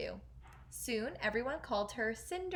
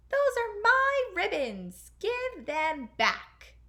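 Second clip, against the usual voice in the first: much higher, louder, slow furious shriek; 11 semitones higher, 9 dB louder, much slower than usual. Acted. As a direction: scream at her in a rage. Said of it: "she shrieked".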